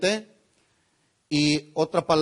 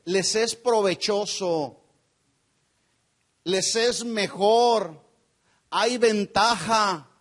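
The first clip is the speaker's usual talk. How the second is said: slow emphasis; slower than usual. Shown as a demonstration. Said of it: "también se traduce".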